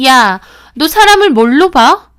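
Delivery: loud indignant "야"; offended shout. Acted. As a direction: lash out, insulted. Friend offended in quarrel.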